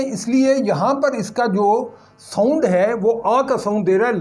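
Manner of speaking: normal talking voice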